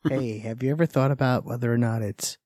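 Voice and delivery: low, soft sultry voice; sexy voice. Acted. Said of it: "I like that sexy voice".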